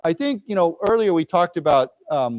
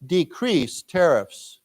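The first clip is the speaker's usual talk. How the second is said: very slow model; much slower than usual. Shown as a demonstration. Teacher reads line for students to echo, very slow. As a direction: spell it out slowly for learners. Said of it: "let's read this".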